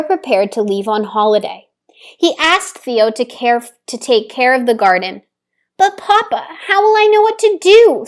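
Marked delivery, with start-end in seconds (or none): 5.8-8.1 higher, louder, fast anxious pleading; a little girl fretting before Papa leaves; worried child. Acted. Theo worries to Papa about gardening alone, higher, louder, fast. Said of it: "Theo worried".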